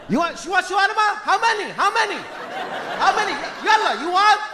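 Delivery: high-pitched, insistent pushy vendor; yelling shawarma seller. Acted. Seller pushing shawarma on customer, yelling. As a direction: pester the customer in a high yell. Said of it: "Why are you yelling at me".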